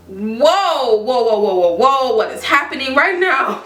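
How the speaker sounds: retold bewilderment, swooping on each whoa; taken-aback reaction. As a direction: act out being blindsided. Acting out stunned reaction to message.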